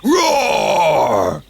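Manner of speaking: loud, long, high roar; big bellowing ogre roar. A narrator's reading. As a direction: roar to scare the picnickers. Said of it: "he yelled".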